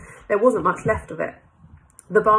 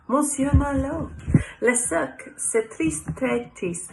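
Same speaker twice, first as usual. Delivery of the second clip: higher-pitched French café owner; French accent; 5 semitones higher, slower than usual. Acted. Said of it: "Did you like my French?".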